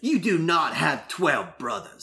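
put-on slow, disbelieving British accent; Minnie Driver accent. Skylar doubting Will's twelve brothers, in a British accent. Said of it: "do it in the Minnie Driver accent".

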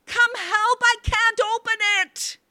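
very high, desperate cry for help; strained, panting shriek. A narrator's reading. Wife calls her husband to the bolt, strained and shrill. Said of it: "strained and panting".

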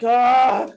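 put-on loud sudden "Ah" of an older person falling; imitated fall cry. Imitating a mature person who fell, loud yelp. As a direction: mimic a pained yelp. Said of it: "they're like".

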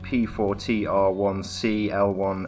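loud, mock-robotic show host intro; announcer voice.

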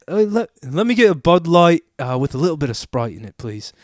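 put-on casual British bar order, mild English accent; imitating a British guy at the bar in a light British accent. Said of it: "like, British accents".

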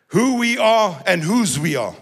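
ordinary talk

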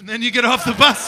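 high, loud, quick repeat; mock exasperation. Comic repeating daughter's bus joke, loud mock exasperation. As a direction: throw the line back loudly, in a rush.

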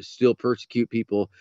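ordinary delivery

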